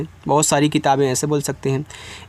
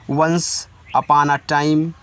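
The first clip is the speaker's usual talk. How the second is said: slow, drawn-out story opener; slow storybook opening; 3 semitones lower, much slower than usual. Shown as a demonstration. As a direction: read it slowly, like the start of a fairy tale. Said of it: "कहानियों की शुरुआत में". (कहानी की शुरुआत जैसा धीमा उच्चारण)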